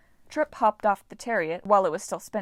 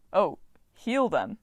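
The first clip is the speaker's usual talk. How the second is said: doubtful; slower than usual. Acted. God dubious about the request.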